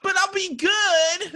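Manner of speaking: very high, very slow pleading; whiny child voice. Retold or imitated. Kid in timeout promising to behave, high and whiny. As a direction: wheedle like a little kid promising. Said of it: "everybody who's like".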